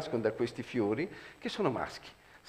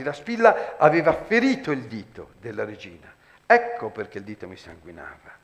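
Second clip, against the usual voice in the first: normal talking voice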